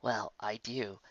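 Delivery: quiet, awkward apology. Acted. Lyra awkwardly apologizing to Twilight after the wedding. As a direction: mumble sorry, embarrassed.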